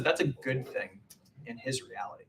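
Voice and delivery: ordinary talk, nothing marked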